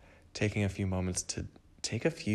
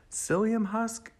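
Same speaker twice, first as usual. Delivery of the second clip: "Psyllium" jumps way up in pitch, slow; high amused repeat; 14 semitones higher, slower than usual. Real, not acted. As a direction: sing "Psyllium husk" high and drawn out. Repeats the odd ingredient name.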